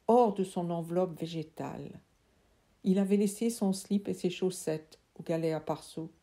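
plain reading voice

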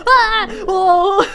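sudden loud startled cry; startled yelp. Real, not acted. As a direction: yelp in surprise. Jump scare.

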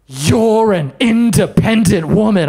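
slow, drawn-out instructor chant; spin instructor mantra. Retold or imitated. Spin instructor chanting to the class, slow and drawn out. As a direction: chant it like a fitness coach. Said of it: "the lady in the front goes".

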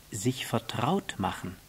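measured statement with falling end; understated fox's definition; fox explaining. Acted.

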